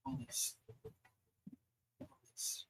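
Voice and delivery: very soft, faint plea with long pauses; drawn-out, hopeless near-whisper; hopeless dying child. Acted. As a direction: barely get the words out, as if giving up. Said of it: "hopeless look in his eye".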